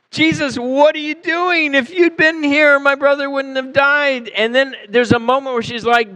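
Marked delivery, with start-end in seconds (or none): 0.1-4.3 higher, pleading reproach to Jesus; accusing disappointment. Retold or imitated. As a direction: let her blame him, hurt and pointed. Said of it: "disappointment, um, even maybe accusation in that".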